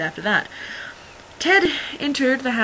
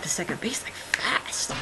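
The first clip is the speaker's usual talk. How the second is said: whisper; 6 dB quieter than usual. Real, not acted. Whispered punchline about fast kid.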